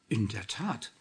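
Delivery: mild wonder in the voice; understated surprise; amazed. A narrator's reading. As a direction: confirm it with a touch of astonishment. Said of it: "bestätigte der alte Mann verwundert".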